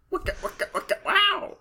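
high, excited DJ shout; hype announcer cry. Pretend. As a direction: throw it out like a hype man.

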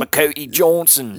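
very high, silly British caricature; mock British accent. Bandmate joining the British bit about [name], high mock British. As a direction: squeal it as a cartoon Brit. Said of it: "starts in on the British accent".

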